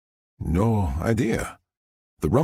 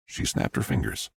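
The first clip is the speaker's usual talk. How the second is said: ordinary delivery, nothing marked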